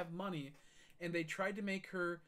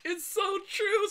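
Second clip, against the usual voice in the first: very high, loud, slow squeal; friend's squeal; 9 dB louder, slower than usual. Retold or imitated. The laughing friend backing up the speaker, in a high squeal. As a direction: stretch it out in a mocking squeal of agreement. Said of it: "he's like".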